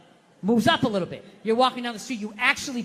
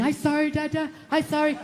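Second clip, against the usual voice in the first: imitated child; 2 semitones higher than usual. His kid apologizing to Dada, imitated child voice. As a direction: imitate your small child saying sorry. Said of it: "one more time it's gonna be".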